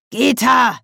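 high, loud call of a name; Zuruf. A narrator's reading. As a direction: call her sharply by name. Figur ruft nach Begleiterin. (laut und hoch gerufen)

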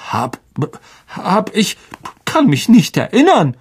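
high, faltering denial; flustered Lockhart. A narrator's reading. Lockhart dodging the teachers' questions, flustered. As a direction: trip over the start, voice rising.

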